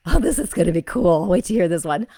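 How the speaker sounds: real thrilled anticipation; excited anticipation. Real, not acted. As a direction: burst out with excitement. Excited about the reading ahead.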